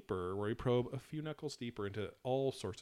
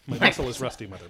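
projected warm-up phrase, loud and full; loud vocal warm-up; 2 semitones higher, 9 dB louder, faster than usual. Shown as a demonstration. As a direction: belt the warm-up line out. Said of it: "That's how I do a vocal warm-up".

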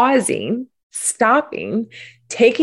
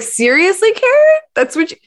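high, incredulous mock self-reproach; scolding herself; 10 semitones higher than usual. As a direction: roll your eyes at yourself, pitched way up. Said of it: "I was like".

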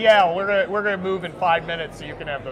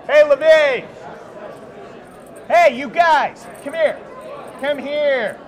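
calling out; 6 semitones higher, slower than usual. Real, not acted. Calling a passerby over.

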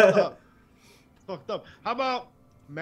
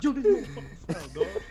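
fast, high, louder imitation of Booker T; mock Booker T voice; 6 semitones higher, 5 dB louder, faster than usual. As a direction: toss it off quick like Booker T. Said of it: "He said".

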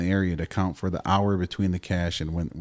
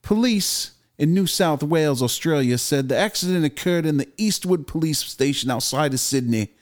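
reading the news in a mock Australian accent, somewhat higher; 6 semitones higher, 3 dB louder than usual. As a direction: ham up an Aussie newsreader. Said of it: "Can you read the story in Australian accent?".

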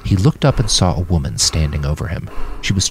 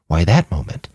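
usual speaking voice

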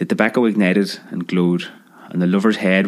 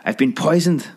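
high, choked panic; high spluttering panic; 7 semitones higher than usual. Acted. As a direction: gasp it out like you're poisoned. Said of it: "spluttered the manly lover".

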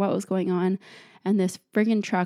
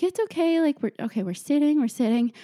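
high-pitched soothing of a dog; retold anxious "it's okay" voice; panicked reassurance; 7 semitones higher than usual. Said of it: "immediate panic".

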